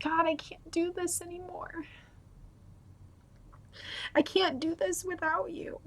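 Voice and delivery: high, soft tearful plea; desperate prayer, voice held small on the edge of tears; close to crying. Real, not acted. Praying to God after the breakup, close to crying. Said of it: "I'm gonna start crying".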